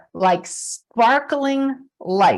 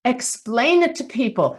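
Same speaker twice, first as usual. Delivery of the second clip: normal talking voice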